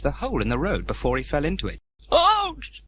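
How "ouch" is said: sharp high yelp of pain; pained cry. Acted. Trevor falling into a hole, sharp high yelp. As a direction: yelp as he falls. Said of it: "cried Trevor".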